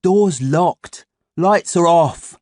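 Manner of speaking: put-on slow, high, loud boy voice; boy character voice. A narrator's reading. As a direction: flatly state it as a schoolboy. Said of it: "He stopped me".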